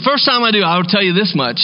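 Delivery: ordinary delivery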